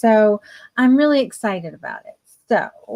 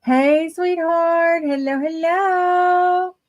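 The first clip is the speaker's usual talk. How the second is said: higher, slow, sweet hello; sing-song greeting; 7 semitones higher, much slower than usual. Real, not acted. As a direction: coo a welcome. Greeting viewer on live stream.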